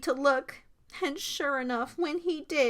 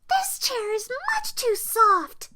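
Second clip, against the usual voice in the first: put-on high little-girl voice; fussy Goldilocks; 13 semitones higher, 3 dB louder than usual. A narrator's reading. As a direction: complain in a high storybook child's voice. Goldilocks voiced, rejecting a chair.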